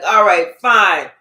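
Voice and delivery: loud, curt mock concession; emphatic, exasperated agreement; grudging consent. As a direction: act out giving in reluctantly. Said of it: "looking at you like".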